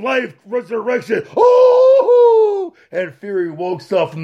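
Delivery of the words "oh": high, loud startled whoop; wild cry. Acted. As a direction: yelp at the impact. Crowd reaction.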